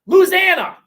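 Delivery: high, drawn-out shouted Louisiana; hammed-up Forrest-style holler; mock Southern yell. Keeps up the Forrest Gump yell.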